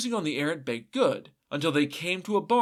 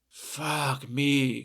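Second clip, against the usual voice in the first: grumbled. A narrator's reading. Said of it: "grumbled the man".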